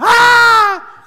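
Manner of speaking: long, high, loud screamed wail; hammed-up toddler shriek; mock child scream. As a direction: imitate a child screaming in public. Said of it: "anak berani nangis teriak".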